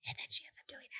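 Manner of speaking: hushed, breathy side comment; whispered aside. Real, not acted. Aside about the plot.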